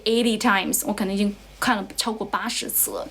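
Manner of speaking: usual speaking voice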